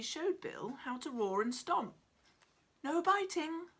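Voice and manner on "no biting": reminding. Acted. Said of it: "reminded Bill".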